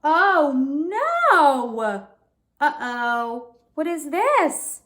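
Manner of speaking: high, worried gasp and oh no; alarmed surprise. A narrator's reading. Reader reacting to the tapping with a high alarmed gasp. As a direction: sound alarmed at the noise.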